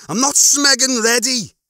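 high, loud, frustrated swearing; worked-up outburst at bad news; furious protest. A narrator's reading. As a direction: hit the curse hard in desperation. Lister kicks again, swearing.

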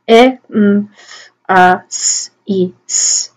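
phonemes of emphasis, each isolated; "E, M, F, A, S, I, S" as speech sounds; sounding out. Shown as a demonstration. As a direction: separate sounds with small gaps. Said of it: "sound them out".

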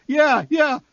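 very high, thin squeak; the words slip out in a tiny squeak. A narrator's reading. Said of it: "my voice squeaked out".

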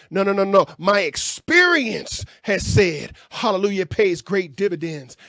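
normal talking voice

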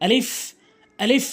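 আলিফ ending in a clear hissed f; correct আলিফ. Shown as a demonstration. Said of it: "উপরের দাঁতগুলো নিচের ঠোঁটে রেখে".

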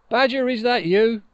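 high and loud, proud little Moon; Moon's voice. Acted. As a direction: pipe up high as the fallen Moon. Moon character voice.

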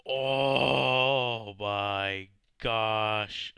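long wailing oh my gosh; a real despairing wail; drawn-out distressed cry. Real, not acted. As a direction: stretch it out in real distress, near tears. Overwhelmed by zombie damage.

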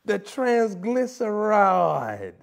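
slowly stretched mock-preacher delivery; mock-preacher slow emphasis; drawn-out word. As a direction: draw out triglyceride like a sermon.

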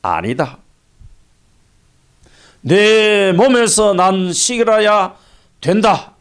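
lightly voiced God, slow and emphatic; God's voice. God correcting Abraham about his heir, firm pronouncement. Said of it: "그러니까 하나님이".

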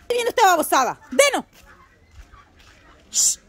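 high, loud calls of the dog's name; shooing the dog. Real, not acted. Owner shooing her dog Dino off the chickens, loud high call. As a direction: shout the dog away from the chickens.

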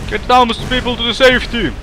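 high-pitched calling out in English; hammed-up call to take cover; mock urgent warning. Calls people to take cover. (korkea, leikillinen varoitushuuto)

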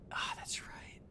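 very soft, unvoiced whisper; whispered realization. Real, not acted. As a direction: whisper it to yourself, deflated. Deflated realization after the mistake.

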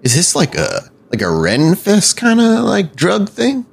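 drowsy voice fading to a mumble; spaced-out raver trailing away; dazed stranger. Acted. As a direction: answer half-asleep and drift off.

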